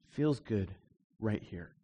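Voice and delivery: soft, low, understated quote; quiet retold reply. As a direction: imitate a man's hushed, low remark. Retold man's quiet, calm reply.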